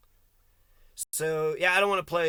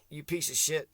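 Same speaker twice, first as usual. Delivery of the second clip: ordinary talk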